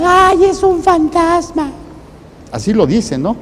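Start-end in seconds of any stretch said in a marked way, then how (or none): 0.0-1.7 high, slow, shrieking mock-terror; terrified scream. Preacher imitating disciples screaming at a ghost, high mock terror. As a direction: squeal it like a frightened fisherman seeing a ghost. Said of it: "Y dicen, gritando, gritan".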